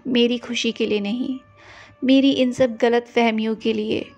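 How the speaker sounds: ordinary talk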